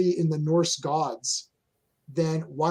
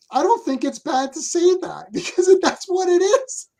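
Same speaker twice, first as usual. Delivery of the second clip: laughing; 14 semitones higher, 4 dB louder than usual. Real, not acted. Laughing while making point.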